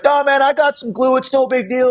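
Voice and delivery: high-pitched imitation of a dismissive guy; imitated casual dismissal; mock brush-off. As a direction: wave the offer away in a put-on high voice. Retold body man brushing off offer.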